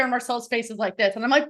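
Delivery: ordinary talk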